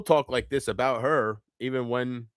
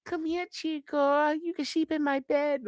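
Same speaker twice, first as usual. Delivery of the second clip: mock seductive lure; 13 semitones higher, 5 dB quieter than usual. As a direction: tease like a fake temptress luring him in. Mocking a luring cartel invitation.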